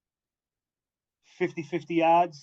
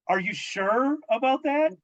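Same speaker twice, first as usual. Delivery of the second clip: sarcastic disbelief, pitched way up; mock-amazed, high and doubtful; incredulous; 8 semitones higher than usual. Real, not acted. Cohost mocking the passenger rule, incredulous.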